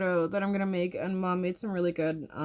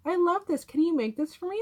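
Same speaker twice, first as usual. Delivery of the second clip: imitating mom; 9 semitones higher, 3 dB louder than usual. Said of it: "my mom was like".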